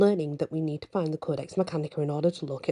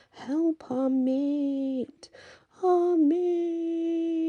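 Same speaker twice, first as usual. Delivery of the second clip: very slow, much higher howl; howled wolf cry; howling inner wolf; 13 semitones higher, much slower than usual. A narrator's reading. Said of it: "My inner wolf starts to howl".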